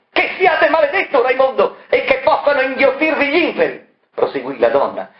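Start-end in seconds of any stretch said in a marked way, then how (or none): 0.1-3.8 very high, raging curse; cursing. Acted. Marella cursing Variconi, high raging invective. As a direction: hurl the curse at him. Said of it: "inveendo contro Variconi".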